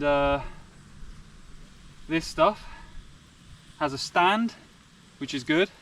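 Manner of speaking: breathless, panting between words; out of breath. Real, not acted. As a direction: talk while catching your breath. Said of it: "sorry, I'm out of breath".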